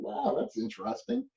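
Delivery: high, soft, wry aside to himself; mock-cheerful understatement about the damage; dry amused inner voice; wry inner thought. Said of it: "I'm thinking".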